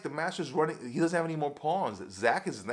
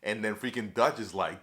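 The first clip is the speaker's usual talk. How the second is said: normal talking voice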